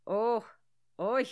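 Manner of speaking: loud mock-Irish exclamation; Irish accent attempt. Friend practising vowels, loud Irish accent attempt. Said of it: "tried to talk in an Irish accent".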